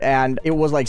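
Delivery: normal talking voice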